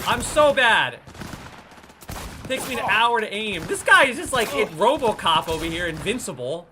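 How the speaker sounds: loud, high exasperated rant; frustrated shouting. Real, not acted. Player who keeps missing the RoboCop enemy, loud exasperation. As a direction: shout your frustration, easing off at the end.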